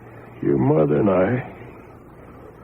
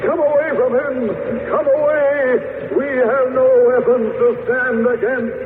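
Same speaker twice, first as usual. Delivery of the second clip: much higher, frightened cries; terrified; 10 semitones higher than usual. Acted. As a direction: back away in fear. Aliens terrified of his power.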